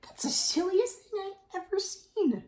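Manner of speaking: rising high into disbelief; cow's incredulous thought. A narrator's reading. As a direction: voice Cow finding it silly, climbing in pitch. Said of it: "what she thought was".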